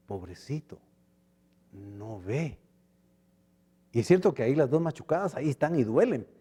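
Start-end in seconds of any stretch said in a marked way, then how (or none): 0.1-2.6 much softer, gentle pity; tender pity. Retold or imitated. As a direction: soften into compassion for the blind man. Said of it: "sino dice".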